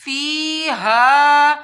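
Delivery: فیہا with both long vowels drawn out very slowly; stretched recitation. Shown as a demonstration. Teacher slowly stretching فیہا in recitation. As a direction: hold فی and ہا each a full count. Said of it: "یہاں بھی اس کو لمبا کرنا ہے".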